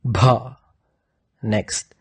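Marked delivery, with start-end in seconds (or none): 0.0-0.6 "Bha" with a strong puff of breath; isolated, heavily aspirated "Bha"; forced aspirated bh. Shown as a demonstration. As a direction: push air hard through the Bh of "Bha". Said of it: "you exert a forced".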